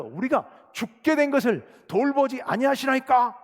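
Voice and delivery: accusing preacher's re-enactment of frightened disciples; reproachful plea. Acted. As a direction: cry out the disciples' complaint, drawing it out. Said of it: "예수님을 책망하는 이야기입니다".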